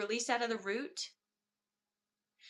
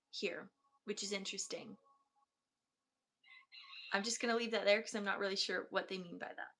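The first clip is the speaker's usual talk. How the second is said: hushed guided healing; 6 dB quieter than usual. Acted. Healer guiding a client through chakras, hushed meditative voice. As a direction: guide the listener down through each chakra, barely above a murmur.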